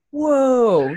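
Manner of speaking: loud, high amazed whoa; surprised. Retold or imitated. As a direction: blurt it out in amazement. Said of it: "And I was like".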